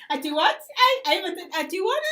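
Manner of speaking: high, loud puzzled question; baffled repeat. Retold or imitated. Retelling being lost in the interview, high and baffled. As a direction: ask again, lost.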